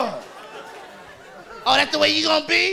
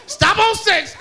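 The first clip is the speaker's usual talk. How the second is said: attendant snapping back; 6 semitones higher than usual. Retold or imitated. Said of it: "He said".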